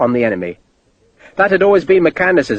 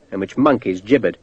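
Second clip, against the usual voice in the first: ordinary delivery, nothing marked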